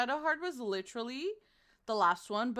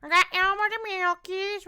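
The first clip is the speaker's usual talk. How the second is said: high, loud put-on voice; goofy mispronounced product name; silly voice; 7 semitones higher, 7 dB louder than usual. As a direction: play the name in a cartoon voice. Mangling product name playfully.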